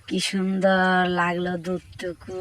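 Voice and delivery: slow, low contented sigh of words; deeply satisfied, drawn-out murmur of pleasure; blissful savouring. Acted. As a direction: stretch it out after a delicious drink. Savouring the stolen milk.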